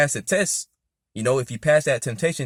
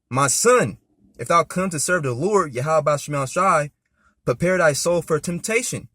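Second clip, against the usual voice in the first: lightly raised solemn reading; scripture reading; 3 semitones higher, 4 dB louder than usual. Acted. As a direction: read it out like a preacher. Reads scripture, preaching style.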